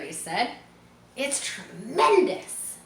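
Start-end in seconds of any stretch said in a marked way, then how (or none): 1.2-2.8 amazed. Acted. Boy amazed at spider.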